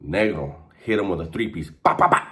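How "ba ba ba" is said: breathy punch noises; punch sound effects. Retold or imitated. Retelling the three-piece, punch sound effects. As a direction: pop out each hit.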